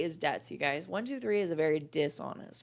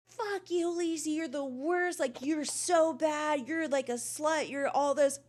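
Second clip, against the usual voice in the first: higher, whiny ranting impression of the troll; mocking Vape Boy; 6 semitones higher than usual. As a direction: mimic his insults mockingly. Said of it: "and he's like".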